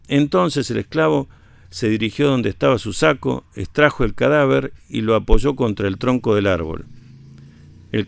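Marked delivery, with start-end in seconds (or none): none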